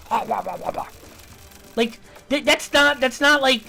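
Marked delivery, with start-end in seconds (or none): 0.1-0.9 dismissive blah. Retold or imitated. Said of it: "shoveling shit in your mouth".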